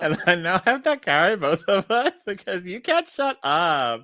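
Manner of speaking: put-on high, slow, giggly valley girl; sassy sorority girl, laughing through it. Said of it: "That's pretty good Valley Girl".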